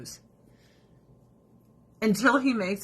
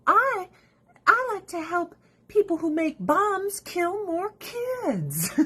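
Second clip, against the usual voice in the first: high-pitched sarcastic imitation; mocking supporter; 10 semitones higher, slower than usual. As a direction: slowly crow it in a high mock voice. Parody of war supporter.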